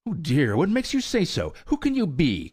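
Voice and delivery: put-on high, eager young colt voice; excited cry. A narrator's reading. Young colt crying out to old mare, excited. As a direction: burst out in startled excitement. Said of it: "cried he in excitement".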